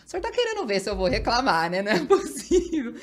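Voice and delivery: laughing as she tells God it can't be; half-laughing mock complaint to God; giggly incredulous plea; laughing disbelief. Retelling prayer, laughing.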